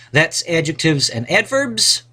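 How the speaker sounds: ordinary delivery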